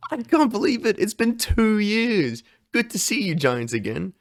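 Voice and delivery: put-on beam it, boyish and delighted; Luffy voice. Voicing Luffy greeting giants.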